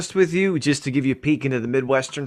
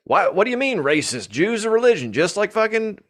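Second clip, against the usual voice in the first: higher-pitched mock Southern electrician; puzzled protest in a put-on Southern accent; 7 semitones higher than usual. Imagined union electrician from Akron objecting, mock Southern accent. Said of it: "Akron has a Southern accent".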